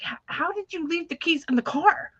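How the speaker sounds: high-pitched, incredulous dad impression; imitated frustrated dad. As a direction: mimic an exasperated dad demanding an explanation. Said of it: "get really frustrated with me".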